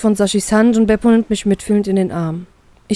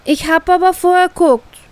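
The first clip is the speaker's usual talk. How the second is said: put-on much higher, louder little girl whining; small child pouting near tears; tearful complaint; 9 semitones higher, 4 dB louder than usual. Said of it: "beschwert sie sich mit Tränen in den Augen".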